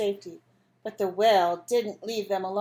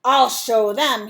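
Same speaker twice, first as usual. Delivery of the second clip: angry; 10 dB louder, slower than usual. Acted. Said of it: "he became very angry".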